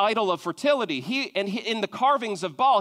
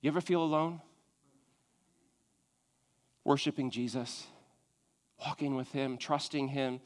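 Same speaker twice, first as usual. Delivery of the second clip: much softer and lower, close and consoling; hushed comfort to the congregation; soft pastoral aside; 8 semitones lower, 8 dB quieter than usual. Acted. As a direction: speak to the lonely one in the room, gently.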